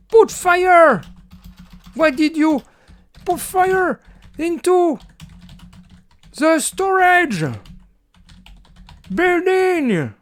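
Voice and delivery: very high, slow screaming accusation; over-the-top furious yell; hammed-up rage. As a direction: scream it in mock fury. Typing an angry shout to AI.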